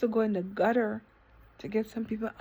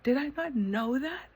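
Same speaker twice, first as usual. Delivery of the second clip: ordinary talk, nothing marked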